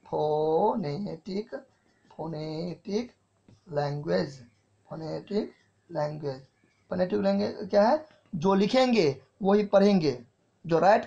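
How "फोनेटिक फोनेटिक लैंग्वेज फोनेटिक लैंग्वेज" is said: drawn out, softer, word by word; slow dictation. Shown as a demonstration. Teacher dictating the term to students, slow and soft. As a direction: say it slowly so the class can write it down.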